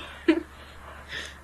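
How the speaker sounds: breathless high giggle; helpless laugh. Real, not acted. Gamer cracking up at her sushi, breathless giggle. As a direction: let the laugh spill out.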